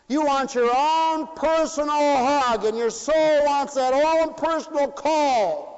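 high, loud, ringing sermon; preaching at full force. Acted. Preacher to congregation, preaching at full force.

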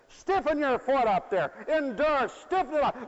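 put-on high, loud commands to a squirming kid; parent's order. Parent wrestling galoshes onto a child, loud order. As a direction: order it loudly, pitched right up.

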